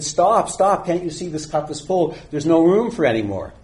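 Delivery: lightly acted professor crying out to halt the pouring; alarmed protest. Alarmed professor protests overflowing cup.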